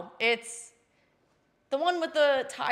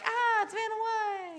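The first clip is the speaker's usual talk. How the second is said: high, slow announcer reveal; game show announcer; 11 semitones higher, slower than usual. Retold or imitated. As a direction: present her like a show host. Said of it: "they'd be like".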